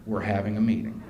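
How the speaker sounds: low, understated answer; matter-of-fact retold put-down; deadpan reply. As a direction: drop low after the shouting. Donald's calm answer after yelling.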